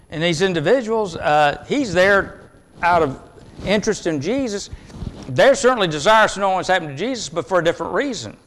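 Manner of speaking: ordinary delivery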